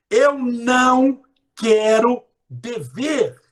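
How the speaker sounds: very slow and loud, não stressed. Shown as a demonstration. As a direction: stretch it out loudly. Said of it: "Você corta o não". (alto e arrastado)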